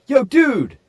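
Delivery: high, loud call for attention. Retold or imitated. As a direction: hail him urgently from the driveway. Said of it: "he's like".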